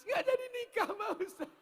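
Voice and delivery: very high, squeaky weeping; comic tearful whine; mock sobbing man. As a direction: wail it out like a jilted man, hammed up. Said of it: "Kok kenapa menangis?".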